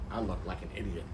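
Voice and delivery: usual speaking voice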